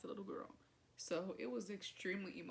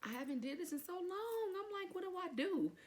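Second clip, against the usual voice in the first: high-pitched mock panic; comically panicked, much higher voice; retold freak-out; 12 semitones higher, 3 dB louder than usual. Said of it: "Like, I'm freaking out".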